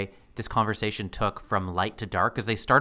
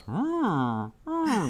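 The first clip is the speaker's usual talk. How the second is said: awkward mock hum; 9 semitones higher than usual. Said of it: "turns very awkwardly and just goes".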